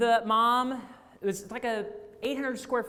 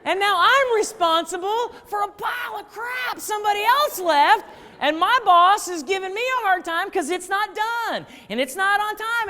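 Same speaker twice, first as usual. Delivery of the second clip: higher, louder, fed-up venting; exasperated rant; 10 semitones higher, 6 dB louder than usual. Retold or imitated. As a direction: rant about someone else's mess. Venting about inherited mess.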